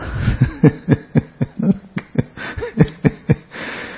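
long breathy chuckle at own anecdote; laughing. Real, not acted. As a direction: break up laughing after the punchline. Speaker laughs at own funeral anecdote.

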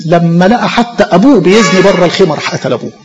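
ordinary talk

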